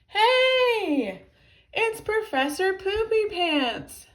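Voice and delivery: high, loud gleeful shout; high-pitched kid's yell; small boy shouting. A narrator's reading. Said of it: "shouted a small boy".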